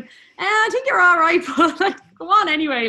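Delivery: high, loud, laughing through the words; laughing reply. Retold or imitated. Retells replying while laughing.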